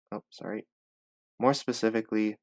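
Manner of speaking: normal talking voice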